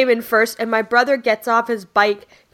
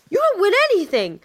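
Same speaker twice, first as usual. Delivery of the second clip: whiny brother; 10 semitones higher, faster than usual. Retold or imitated. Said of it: "he was like".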